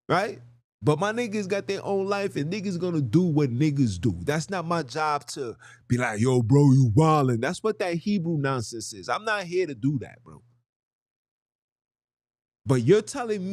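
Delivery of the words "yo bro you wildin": loud, higher mock scolding of a friend; mock call-out. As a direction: call your buddy out, raised voice. Acts out calling out a friend.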